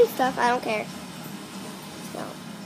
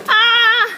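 yelp; 6 semitones higher, 6 dB louder than usual. Real, not acted.

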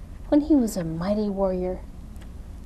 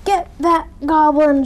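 loud, raised cry of a pursuing crowd; shouting; 7 semitones higher, 6 dB louder than usual. A narrator's reading. Crowd sets on Goblin, loud high shout. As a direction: shout the order high and hard. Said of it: "shouted everyone".

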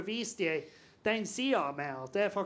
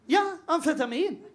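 higher and louder, laughing, half-dazed; mock groggy mom; 7 semitones higher, 4 dB louder than usual. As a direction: play the mom waking from anaesthesia. Drowsy mom after anaesthesia.

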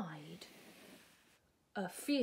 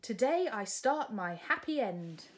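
joyful princess on horseback; delighted princess; 6 semitones higher, 6 dB louder than usual. Acted. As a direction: savour being free. Sue's voice.

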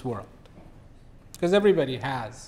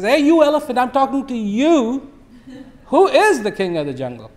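higher, louder irritated tiger yelling; the tiger yells up at the silent elephant, irritated; irritated shout; 8 semitones higher, 5 dB louder than usual. Acted. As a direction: demand an answer, fed up and shouting. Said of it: "tiger was quite irritated".

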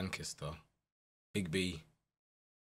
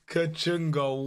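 ordinary talk, nothing marked

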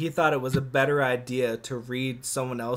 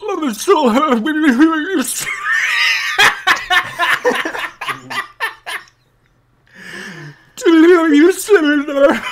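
high, loud, laughing monster shout; 12 semitones higher, 10 dB louder than usual. Acted. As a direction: shout it with giddy monster joy. Monster answers joyfully.

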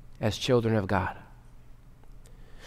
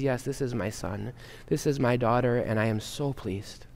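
much softer, lower loving affirmation; tender whisper; 5 semitones lower, 10 dB quieter than usual. Retold or imitated. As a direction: say it gently like a father to his child. God gently affirming the baptized child.

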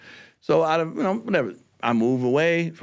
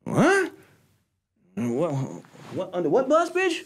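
higher, indignant retold tirade; mock outrage; 12 semitones higher than usual. Replaying telling off the wife, higher mock outrage. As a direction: act out how offended he was.

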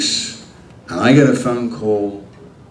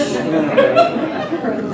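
mocking laugh. Real, not acted. Promised meaningful lines.

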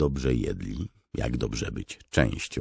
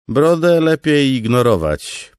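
high, loud jovial lieutenant; amused aside tip; 13 semitones higher, 12 dB louder than usual. Acted. Noro advising Kaladin to ignore Beard, loud jovial aside. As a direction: let him in on the joke. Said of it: "podpowiedział porucznik Noro".

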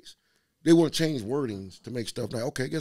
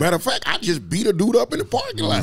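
laughing; 10 semitones higher, 8 dB louder than usual. Real, not acted. Laughing while telling it.

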